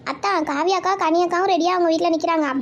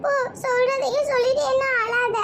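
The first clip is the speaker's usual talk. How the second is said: tearful sulking; 6 semitones higher than usual. Acted. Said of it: "அழாதம்மா".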